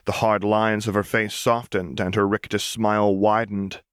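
usual speaking voice

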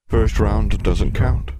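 loud, slow monster voice; big beast pronouncing a ruling; domineering sphinx. Acted. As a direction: lay down the rule slowly and loudly. Sphinx overrules the girl.

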